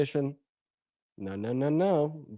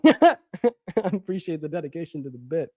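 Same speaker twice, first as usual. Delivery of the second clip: laughing; 3 semitones higher, 7 dB louder than usual. Real, not acted. Laughing at caller's bit.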